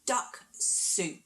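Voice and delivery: normal talking voice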